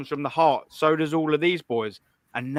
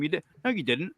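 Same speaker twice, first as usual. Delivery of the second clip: ordinary talk, nothing marked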